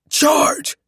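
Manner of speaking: high, loud emergency order; urgent doctor's shout; shouted command. A narrator's reading. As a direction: call it out sharply to the team. Doctor calls out defibrillation.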